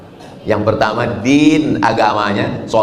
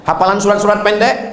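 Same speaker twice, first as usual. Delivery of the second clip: stern examiner; 8 semitones higher than usual. Acted. Ustadz interrogates suitor.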